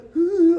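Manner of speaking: loud high "hoo"; high, loud mock hoot; imitated drunken whoop. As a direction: whoop like a stumbling drunk creature. Imitates cryptid-like friend running past car.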